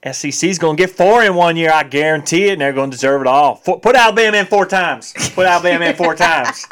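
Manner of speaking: mock SEC fan. Mocking SEC bias to cohost, hammed-up fan rant. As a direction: rant like a die-hard Alabama fan.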